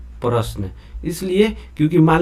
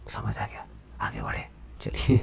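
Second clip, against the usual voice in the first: very quiet, low murmur; barely voiced, low check-in; hushed aside; 15 dB quieter than usual. Real, not acted. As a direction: drop to a near-whisper to check they follow. Quiet aside checking class before moving on.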